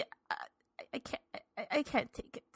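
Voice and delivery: whispered, choked up; words failing her; breaking down. Acted. Old woman at the council, whispering in distress.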